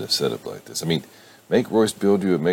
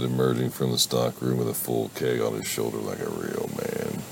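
ordinary delivery

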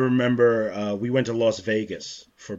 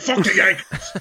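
half-laughing yelled insult, higher and louder; mock angry yell; 7 semitones higher, 5 dB louder than usual. Boston locals yelling at a New York plate, laughing mock shout. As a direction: bark it like a riled Boston driver. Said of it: "they'll just like".

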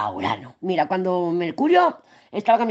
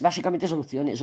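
ordinary talk, nothing marked

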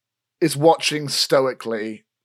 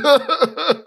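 smug laugh; 12 semitones higher, 5 dB louder than usual. Acted.

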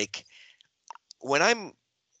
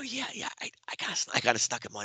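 whispered, fast and sheepish; 3 semitones higher, 5 dB quieter, faster than usual. Retold or imitated. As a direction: whisper it like a guilty confession. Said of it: "And I go".

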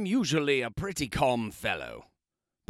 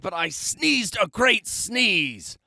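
building to a sneeze; 6 semitones higher, 4 dB louder, slower than usual. A narrator's reading.

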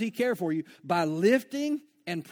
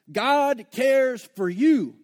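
slow and louder, leaning on each word, with you landing hardest; slow emphatic stress; 4 semitones higher, 6 dB louder, much slower than usual. Acted. Preacher assuring the worn-down congregation, slow and emphatic. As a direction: drag it out and punch it home.

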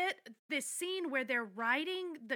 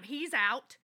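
ordinary talk

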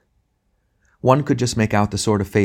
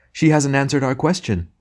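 grand tragic wailing; 6 semitones higher than usual. Acted. Dwarf and tragedian in unison.

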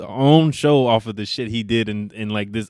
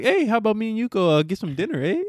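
put-on Canadian accent; 10 semitones higher than usual. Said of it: "he got the accent and everything".

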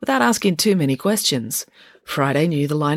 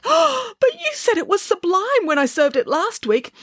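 very high, slow wail; tearful protest; slower than usual. A narrator's reading. Cook close to tears over her criticised dish, tearful protest. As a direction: wail it in wounded, high-pitched dismay. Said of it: "definitely tears running down".

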